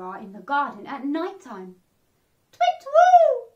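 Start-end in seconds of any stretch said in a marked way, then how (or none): 2.5-3.5 very high, loud owl call; owl hoot. Acted. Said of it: "went the owls".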